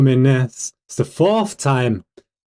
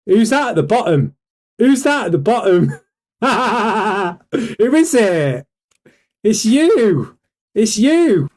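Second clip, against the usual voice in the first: gleeful higher-pitched call-out; taunting; 5 semitones higher than usual. Real, not acted. Streamer calling out the man he is exposing, taunting. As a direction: point the finger, pitched up and teasing.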